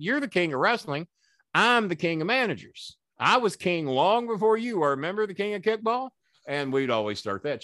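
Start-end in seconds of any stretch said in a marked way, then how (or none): 0.0-6.1 high-pitched wrestling brag; feud boast. Retold or imitated. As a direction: puff yourself up against a rival king. Acted feud boast to Lawler.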